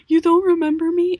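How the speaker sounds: high, loud, wounded disbelief. Retold or imitated. Acquaintance shocked that the speaker forgets her, loud and high. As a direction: gasp it out as if hurt to be forgotten. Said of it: "they're like".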